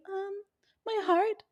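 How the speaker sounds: high, soft heartbroken voice; mock pitiful caller. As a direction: whimper it like a lovesick kid. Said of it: "I can imagine the call".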